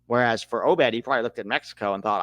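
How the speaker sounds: ordinary delivery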